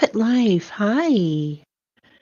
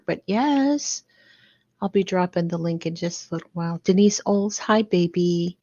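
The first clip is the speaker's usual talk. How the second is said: ordinary talk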